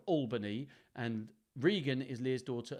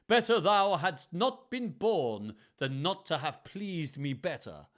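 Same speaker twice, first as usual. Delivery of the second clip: grand, slow, raised stage voice; King Lear; 3 semitones higher, 6 dB louder, slower than usual. Acted. Reader quotes Lear's line, slow grand declaiming. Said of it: "This is a line from King Lear".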